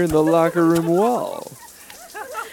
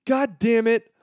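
normal talking voice

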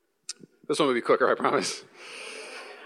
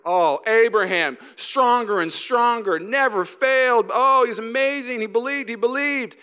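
higher, slow gushing awe; mock reverent praise; 6 semitones higher, slower than usual. Reader gushing over Abraham's faith, slow mock awe. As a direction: gush over Abraham, drawn out. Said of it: "read Romans four".

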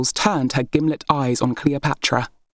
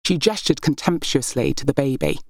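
ordinary delivery, nothing marked

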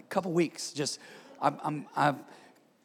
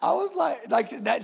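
higher, laughing as he tells it; cracking up at the memory; 6 semitones higher, 3 dB louder than usual. Real, not acted. Pastor laughs retelling his wife's Chewbacca remark, laughing.

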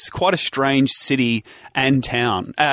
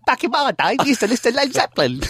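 put-on high, rushed old-timer caricature; mock a friend's impression of dad; quick, high-pitched mocking dad voice; 10 semitones higher, faster than usual. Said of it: "whenever I do an impression of your dad".

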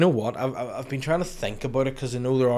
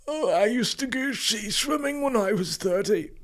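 put-on old person voice; 12 semitones higher than usual. Imitating aged swimmer.

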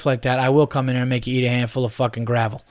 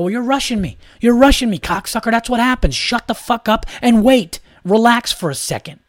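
mock angry confrontation; 8 semitones higher than usual. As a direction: throw his own words back at him, furious but playing. Imagined confrontation with the rushing dad.

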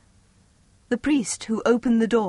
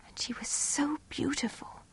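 awed whisper; 6 semitones higher, 11 dB quieter than usual. A narrator's reading. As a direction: whisper it in wonder. Awed description.

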